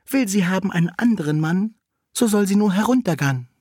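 very high cat messenger; maid cat. A narrator's reading. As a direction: pipe the rhyme way up high, as the cat. Cat relays the wolf's proposal.

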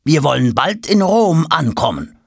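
high, commanding servant order; stern command. Acted. Servant sternly ordering Tom back to rowing. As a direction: send him back to the oar.

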